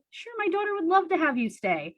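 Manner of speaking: high-pitched, welcoming mom voice; imagined mom answering. Acted. Imagined mom welcomes daughter's crush, high mom voice. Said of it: "And my mom to say".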